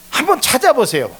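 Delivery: ordinary talk, nothing marked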